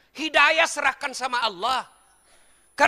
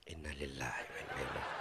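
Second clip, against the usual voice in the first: hushed, breathy whisper of the prayer, much softer; 18 dB quieter, faster than usual. Acted. Preacher whispers a mock condolence prayer to the crowd. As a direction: drop to a secret whisper. Joke pause, muttered prayer aside.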